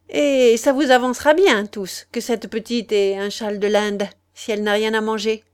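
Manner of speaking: put-on loud, scornful retort from the old lady; old lady scolds the family, loud and scornful; sharp retort.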